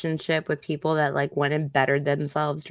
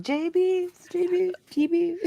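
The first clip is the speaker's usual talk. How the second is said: mock dopey voice; 11 semitones higher than usual. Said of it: "We're like".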